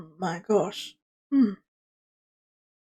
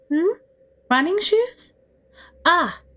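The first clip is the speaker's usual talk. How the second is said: much higher, puzzled then delighted; Don Quixote surprised; 10 semitones higher, 3 dB louder, slower than usual. Acted. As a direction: perk up in a high knightly voice. Don Quixote brightens at the question.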